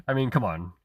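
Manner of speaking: ordinary delivery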